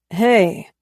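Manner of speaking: annoyed. A narrator's reading. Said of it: "answers the phone a little annoyed".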